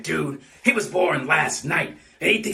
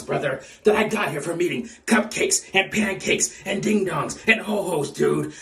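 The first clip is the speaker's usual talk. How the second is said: ordinary talk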